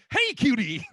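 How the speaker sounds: high, loud leering call of "Hey, cutie!"; creepy catcall. Retold or imitated. Mocking a guy who jumps out at night catcalling women. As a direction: jump out and catcall a stranger at night. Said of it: "out at night and being like".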